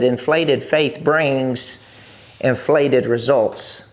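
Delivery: very slow, each word spaced out; slow recitation. Shown as a demonstration. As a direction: lay "Inflated faith brings inflated results" out slowly for the congregation to repeat. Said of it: "say it with me".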